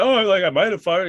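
high, loud mock-drunk reply; hammed-up drunk protest; imitating drunk friend. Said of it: "He's drunk".

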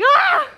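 high yelp of fright, hammed-up; sudden shriek of terror in jest; mock scream. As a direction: let out a short startled scream. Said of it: "she's like".

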